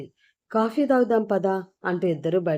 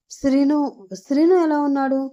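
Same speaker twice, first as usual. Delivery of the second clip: urgent, higher and louder worried call; calling out in alarm for him; anxious; 7 semitones higher, 4 dB louder than usual. A narrator's reading. Amulya waking, anxiously asking after Srinu. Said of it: "అంటూ కంగారుగా లేపబోతుంది".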